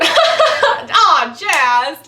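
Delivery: laughing, high and warm. Retold or imitated. Actor laughing at the gushing fan. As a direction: chuckle the nickname fondly. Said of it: "started laughing".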